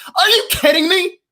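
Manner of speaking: can't believe what he's seeing; are you kidding me, real frustration; exasperated outburst. Real, not acted. Ranting about team.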